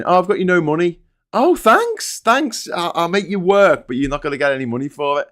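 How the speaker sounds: hammed-up stingy boss; cheeky put-on voice; mock Wayne voice.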